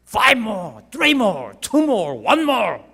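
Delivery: high, loud countdown shouts; coach shouting. Acted. Coach urging Brock on, shouting. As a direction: shout each rep out to push him on. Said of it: "그 브록한테 외치는 것처럼".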